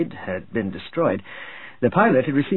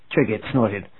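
ordinary delivery, nothing marked

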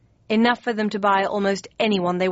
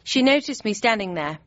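ordinary delivery, nothing marked

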